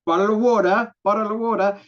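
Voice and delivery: high, loud mock-British imitation; hammed-up British accent as aped by Americans; mimicked British accent. Coach mimicking American friends taken with British speech, mock British accent. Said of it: "Americans ... very much taken by the".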